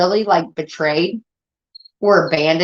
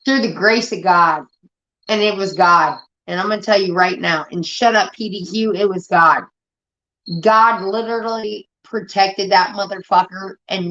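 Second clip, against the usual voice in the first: usual speaking voice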